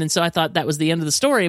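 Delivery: usual speaking voice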